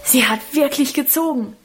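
put-on boy's astonished call from a distance; called from afar. A narrator's reading. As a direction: call it across the street in disbelief. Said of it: "rief Lebrecht aus der Ferne". (aus der Ferne staunend gerufen)

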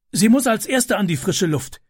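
high, breathless urgency; panting. Acted. Neighbour panting as he climbs out. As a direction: gasp it out through smoke. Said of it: "keuchte der Mann".

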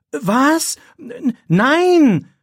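high, loud startled "Nein!"; shocked cry. A narrator's reading. Accused boy cries out in shock at the teacher. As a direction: jolt of horror at the accusation. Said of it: "Philipp erschrickt".

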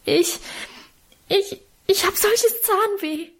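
put-on high, drawn-out whine of a child in pain; wailing. A narrator's reading. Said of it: "jammerte ich".

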